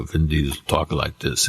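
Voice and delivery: low Vin Diesel voice. Retold or imitated. Showing the hosts the voice-match reference, low like Vin Diesel. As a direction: drop low into a deep tough-guy rumble. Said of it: "It was very low".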